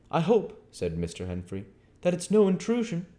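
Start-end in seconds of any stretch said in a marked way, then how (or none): 0.1-0.7 higher, polite clockmender; Henfrey's voice. Acted. Henfrey apologizing to the stranger, higher polite voice. 2.0-3.1 higher, polite clockmender; Henfrey's voice. Acted. Henfrey apologizing to the stranger, higher polite voice.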